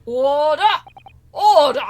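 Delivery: loud gavel-banging call for order; judge shouting. Acted. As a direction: bellow it like a judge. Judge calls court to order.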